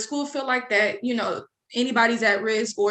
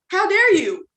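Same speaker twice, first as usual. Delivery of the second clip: indignant; 8 semitones higher, 5 dB louder, slower than usual. Retold or imitated.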